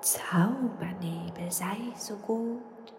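very slow, soft whispered incantation; mysterious ghost spell. Acted. Ghost casting a magic spell, very slow and whispered. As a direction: chant a secret spell into the fog. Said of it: "schuhute geheimnisvoll einen Geisterzauberspruch".

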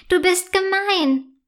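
put-on high, upset child's cry; shouting. A narrator's reading. Boy yells at the girl who kicked the castle. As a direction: yell the accusation at the bully. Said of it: "schreit Jasper".

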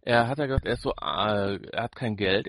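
ordinary delivery

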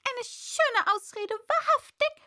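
high, loud scornful bird voice; deep contempt. A narrator's reading. Pigeon scorning Alice's excuse, high and loud. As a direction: sneer at the excuse. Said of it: "im Tone tiefster Verachtung".

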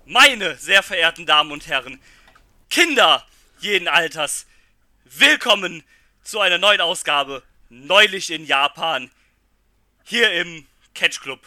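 booming, slow ringmaster announcement. Acted. Opens show as announcer.

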